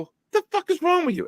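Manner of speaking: very high, quick mock outburst; retold exasperation. Host retells scolding his lost friend, exasperated. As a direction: rush it out in a squeaked-up pitch.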